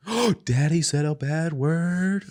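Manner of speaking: put-on high, slow sing-out in a kid's voice; tattling child. Kid catching Daddy swearing, scandalised. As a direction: imitate a scandalised little kid. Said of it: "my kids".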